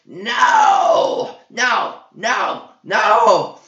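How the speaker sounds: high piercing shrieks of no; shrieking. A narrator's reading. Creeple shrieks as the king smashes his sphere, high and piercing. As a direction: shriek it like your world just shattered. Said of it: "Creeple shrieks".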